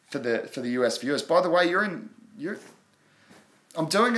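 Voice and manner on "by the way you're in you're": normal talking voice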